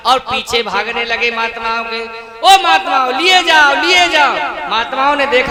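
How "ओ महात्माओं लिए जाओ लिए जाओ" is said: loud, higher shout down the lane; calling out after them. Acted. Kabir chases the fleeing holy men, calling out loudly. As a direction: call after them to take it.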